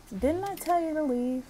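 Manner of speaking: high, loud indignant question; play-acted scolding. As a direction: demand it like a fed-up tenant. Acted quarrel, ordering visitor out.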